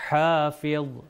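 hafidh with ha from the throat; throaty ha, heavy dha. Shown as a demonstration. Teacher repeating for learners, careful ha and heavy dha. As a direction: draw out ha, then thick dha. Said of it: "آپ وا کا تلفظ کریں".

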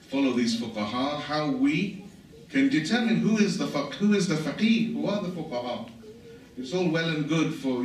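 ordinary delivery

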